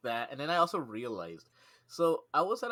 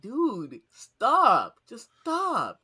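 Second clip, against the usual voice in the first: higher, louder repeated stop; exasperated yell; 10 semitones higher, 10 dB louder, much slower than usual. Retold or imitated. Coworker swatting moths, exasperated yell. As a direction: snap at him to quit swinging. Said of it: "I was like".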